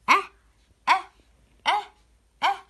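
put-on short open أه sound repeated for children; sounding out fatha. Shown as a demonstration. Teacher showing pupils hamza with fatha, sounding out أه. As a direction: model أه with an open a vowel, each one separate. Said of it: "ما حركته؟ فتحة".

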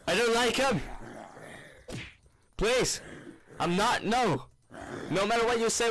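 high-pitched whiny protest; panicked pleading. Real, not acted. Begs attacking zombies to leave.

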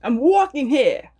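high, slow, hammed-up New York accent; broad New York "wawking heah". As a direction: exclaim it like a mock New Yorker. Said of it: "Is that how you say it?".

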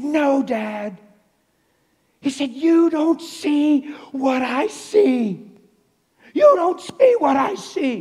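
high, loud, slow desperate plea; freaking out. Retold or imitated. Son insisting to his dad, high and loud, panicked. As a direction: cry it out like a panicked boy. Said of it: "He's freaking out".

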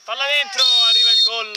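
high, loud, screaming goal call; breathless italian goal commentary. Acted. Goal called in live commentary.

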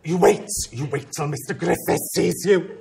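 put-on lightly Welsh, threatening the boy as she drags him; Welsh threat. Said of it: "She was very Welsh".

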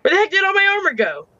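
a high exasperated whine about the missing armor; exasperated high outburst. Real, not acted. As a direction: pitch jumps up high in frustration. Can't find his armor.

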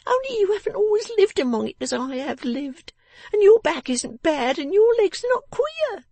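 very high, loud, aggrieved voice; resentful little Jenny. Acted. Jenny contrasts her hard life with Lizzie's, resentful and high. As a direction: protest in a high, sore little voice.

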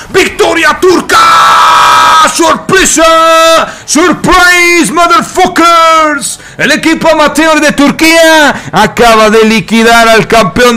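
very high, loud, stretched announcer yell; real ecstatic esports victory call; triumphant victory shout. Real, not acted. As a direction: scream the win, dragging out the big words. Commentator announces the upset win.

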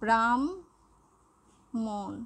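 ব্রাহ্মণ stretched very slowly, sounds held; slow drawn demo. Shown as a demonstration. Teacher to students, slow drawn-out demo of ব্রাহ্মণ. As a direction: draw ব্রাহ্মণ out for the class. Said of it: "যেমন যদি বলি".

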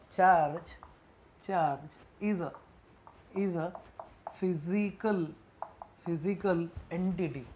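very slow, phrase-by-phrase dictation. Shown as a demonstration. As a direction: dictate slowly with gaps, repeating chunks. Dictating a definition for writing.

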